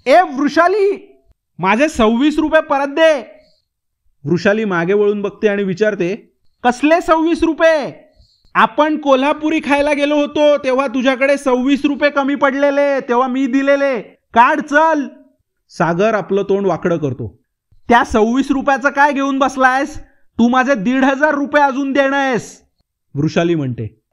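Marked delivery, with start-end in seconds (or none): 0.1-3.4 loud high call across the distance, drawn out; shouting after her. Acted. Ajay shouts after Vrushali across twenty feet, calling loudly. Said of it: "Ajay अचानक ओरडतो". 6.6-15.1 heated retort. Acted. Vrushali fires back at Ajay over old money, heated. 17.9-22.6 heated retort. Acted. Vrushali counters Ajay with his debt, heated. Heated money quarrel between friends.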